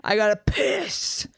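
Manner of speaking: slow, drawn-out comic declaration; hammed-up urgent announcement; blunt announcement. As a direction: stretch it out shamelessly for your friend. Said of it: "where we just go like".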